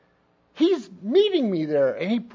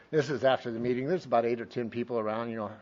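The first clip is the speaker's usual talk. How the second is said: ordinary delivery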